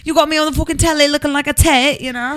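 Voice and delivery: high, hammed-up Essex accent; mock indignation in an Essex accent; put-on Essex accent. Telling off a Lyft driver in a put-on Essex accent. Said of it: "I have an accent now".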